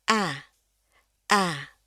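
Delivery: "A" as a wide æ; English smiling A. Shown as a demonstration. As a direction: say "A" through a broad smile. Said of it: "Jadi ini harus bunyinya". ("A" sebagai æ dengan senyum lebar)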